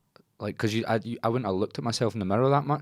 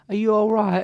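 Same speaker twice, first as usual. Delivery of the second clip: put-on high, sweet toddler asking gently; little kid Cockney voice; 5 dB louder than usual. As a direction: squeak it like a caring little kid. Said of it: "a little Cockney accent".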